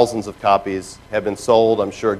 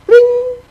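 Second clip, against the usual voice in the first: very high short bling like a chat alert; messenger bling; 6 dB louder than usual. Retold or imitated. Imitating the instant messenger alert, high bling. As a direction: chime it like a message tone. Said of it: "your little instant messenger goes".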